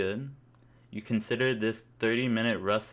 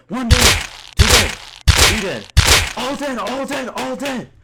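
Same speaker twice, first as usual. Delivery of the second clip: high, loud frenzied screaming; 15 dB louder than usual. Acted. Gamer screams kill count to his squad, frantic high yells. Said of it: "while screaming".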